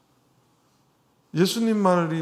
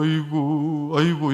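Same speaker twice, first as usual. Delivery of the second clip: long, level ritual keening; imitated funeral wailing; 4 dB louder, slower than usual. Shown as a demonstration. Chief mourner leaning on a staff, imitated wailing. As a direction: wail it formally like a chief mourner. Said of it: "이렇게 했어요".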